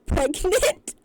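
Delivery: loud, high yelp of disbelief; shocked cry. A narrator's reading. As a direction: cry it out as a stunned question. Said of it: "Randy cries".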